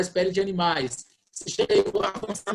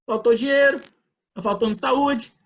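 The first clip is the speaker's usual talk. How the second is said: higher-pitched voice of someone challenging the text; questioning believer; 6 semitones higher, 5 dB louder than usual. Acted. A believer questioning the verse over what is missing, questioning protest. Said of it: "alguém pode chegar e questionar".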